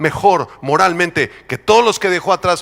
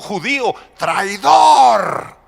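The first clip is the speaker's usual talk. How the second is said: shouted accusation; 3 semitones higher, much slower than usual. Acted. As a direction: shout it like hurling an insult. Preacher hurls accusation as onlookers.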